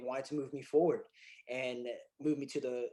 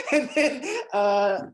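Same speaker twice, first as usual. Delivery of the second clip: ordinary delivery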